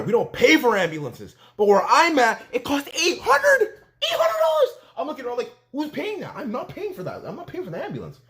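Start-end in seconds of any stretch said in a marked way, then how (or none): 2.5-4.8 very high squeaky disbelief, drawn out; hammed-up falsetto incredulity; comic outrage. Outraged at ambulance price. 5.7-8.2 high, hushed squeaky protest; comic high-pitched grumbling; mock indignation. Acts out refusing to pay.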